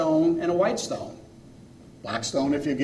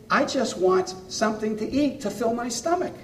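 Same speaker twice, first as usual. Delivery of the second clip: higher-pitched bear making a modest request; lightly voiced bear, pitched up, stating its wish; bargaining bear; 8 semitones higher than usual. Bear names its side of the bargain, higher-pitched modest plea. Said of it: "He says".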